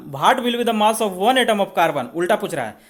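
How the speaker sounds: usual speaking voice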